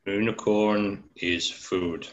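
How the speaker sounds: slow, lightly voiced frog; a hint of a slow monster frog. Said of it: "Frog impression".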